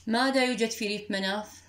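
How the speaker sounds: usual speaking voice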